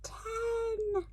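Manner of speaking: very high, soft squeak; tiny voice. Acted. The shrunken witch squeaking her last count, very high. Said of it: "came a tiny voice".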